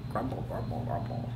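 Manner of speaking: very low, hushed grumbling words; tummy grumble. Acted. Reader voices the whale's hungry tummy, low grumble. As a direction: grumble it softly at the bottom of your voice.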